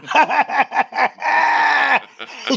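high cackling shriek; mock shrieking laugh. [name] cackling from the other room, high shriek.

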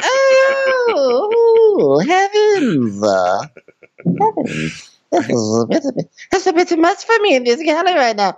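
very high fussy squeal; hammed-up shock in a falsetto; mock scandalized falsetto. Mock shocked reaction to loud punchline.

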